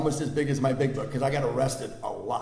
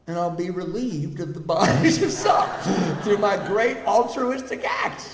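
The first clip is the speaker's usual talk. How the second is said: laughing; 6 semitones higher than usual. Retold or imitated. Mocking his own grand plan.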